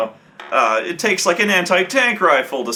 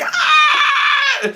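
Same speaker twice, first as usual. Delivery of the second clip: a piercing scream, much higher and louder; high-pitched scream; 4 dB louder than usual. Retold or imitated. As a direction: shriek like a man in agony. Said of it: "screams so, so at a, such a high pitch".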